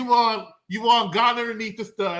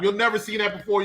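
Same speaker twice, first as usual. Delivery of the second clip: usual speaking voice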